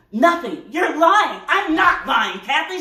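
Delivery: loud, high, rapid-fire bickering; heated quarrel. Acted. Engaged couple fighting over mother's call, heated. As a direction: snap back and forth, high and loud.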